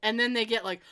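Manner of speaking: usual speaking voice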